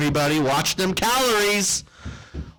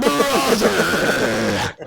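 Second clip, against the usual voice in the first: wrestler catchphrase; 4 dB louder than usual. Acted.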